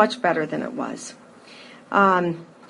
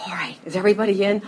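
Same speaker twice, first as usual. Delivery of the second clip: ordinary delivery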